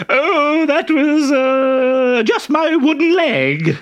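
very high, loud simpering falsetto; disguised Harlequin. Acted. Harlequin in a dress flirting with the captain, high simpering falsetto. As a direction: gush like an ugly coy bride.

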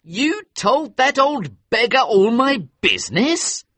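high, loud indignant outburst; outraged young man, voice up high; angry. A narrator's reading. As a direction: demand it, stung and high. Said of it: "Hughie was now angry".

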